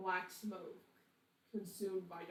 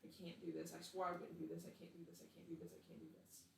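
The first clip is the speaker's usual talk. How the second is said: Kira中的慌乱拒绝; 4 dB quieter, faster than usual. Acted. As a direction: murmur it over and over, trailing away. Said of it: "she says".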